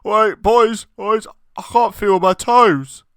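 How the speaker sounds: muffled under noodles. Acted. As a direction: voice buried under a pile. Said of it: "Muffled voice".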